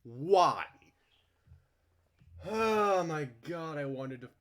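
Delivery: loud high drawn-out wail; loud slow oh my God; exasperated outcry. Real, not acted. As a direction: cry out in disbelief, slowly. Exasperated outburst.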